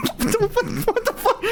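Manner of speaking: giggly high disbelief; laughing disbelief. Real, not acted. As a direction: laugh it out, can't believe it. Laughing at dad's reaction.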